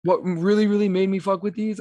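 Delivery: ordinary talk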